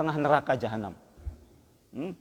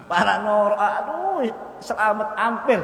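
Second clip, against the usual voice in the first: excited exclamation; 5 semitones higher, 4 dB louder, slower than usual. Acted. Preacher exclaims near-miss.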